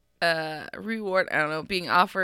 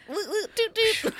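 high laughing woot woot toot; mouthed car alarm; 11 semitones higher than usual. Pretend. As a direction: chant it like a silly siren. Giggle train sound.

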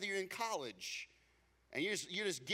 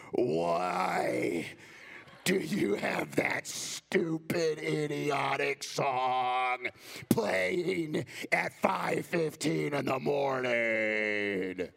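louder, slow, drawn-out annoyed complaint; irritated wife; 2 semitones lower, 8 dB louder, much slower than usual. Retold or imitated. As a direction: imitate the groggy, fed-up wife at dawn. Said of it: "my loving wife said in a very loving voice".

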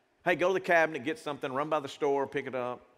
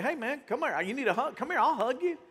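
welcoming store owner; 8 semitones higher than usual. Retold or imitated. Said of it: "this guy's like".

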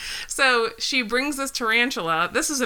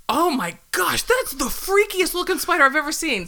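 amazed; 7 semitones higher than usual. Retold or imitated. Retelling stranger's amazed reaction.

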